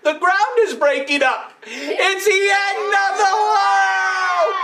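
teacher leading the class in a panicked chant; mock panic. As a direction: cry out like the sky is falling. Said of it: "The first rabbit said".

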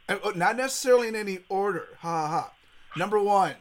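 normal talking voice

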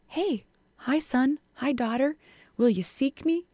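soft fatherly invitation; gentle voice of God. Acted. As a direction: invite them kindly, like a loving father. Voices God calling his children.

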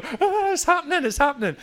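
a high squeal of excitement; giddy whispered-style squeal to the wife; excited. Retold or imitated. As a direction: squeal it under the table. Kicking each other under table.

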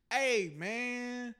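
high, drawn-out stoner plea; hammed-up stoner complaint; stoned teen. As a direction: whine it slowly, loud and high, as if stoned. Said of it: "I'm high, dog".

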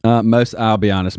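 usual speaking voice

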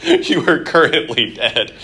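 usual speaking voice

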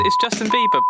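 very high, squealed name; teasing falsetto; squeaky voice. Pretend.

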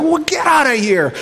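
high calling-out shout at the cows; yelling to chase cows from the yard; shooing cows. Retold or imitated. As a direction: shoo them off, calling out.

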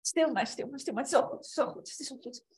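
high, hushing comforter; soothing a child. Acted. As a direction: hush them like a crying child. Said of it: "de troosten".

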